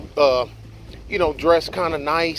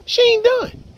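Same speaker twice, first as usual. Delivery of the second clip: she ain't done pitched very high; high emphatic. Real, not acted. Repeated, much higher.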